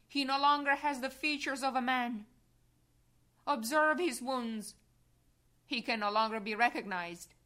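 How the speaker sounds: loud proclamation. Acted. Pilate showing Jesus to the crowd, booming loud. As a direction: proclaim it across the square. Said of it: "and says in a loud voice".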